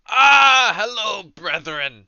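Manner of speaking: booming, slow welcoming priest. Acted. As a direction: greet them like a grand cleric.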